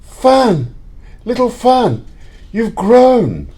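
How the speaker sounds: overjoyed to see her again; bright happy exclamations to a little sister; joyful reunion. Acted.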